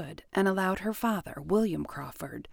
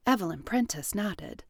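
usual speaking voice

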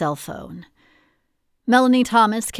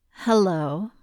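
sultry. Acted. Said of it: "From her sultry tone".

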